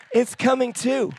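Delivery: a preacher's rising echo; emphatic repeat. Real, not acted. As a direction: declare it again with force. Repeats phrase, punching it.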